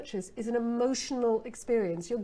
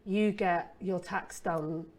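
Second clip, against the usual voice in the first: slow, lower and softer, dragging; bored feature list; 4 semitones lower, 3 dB quieter, slower than usual. Shown as a demonstration. Copywriter listing a dull tax feature, bored and slow. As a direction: read it like dull small print. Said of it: "those are the boring, f-, literal features".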